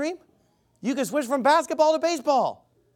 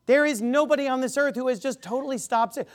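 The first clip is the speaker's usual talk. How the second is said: ordinary talk, nothing marked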